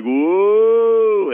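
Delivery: long high, loud "woo"; imitated cheer, drawn out and high; crowd's whoop. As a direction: whoop like a whole excited crowd. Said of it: "everyone's like".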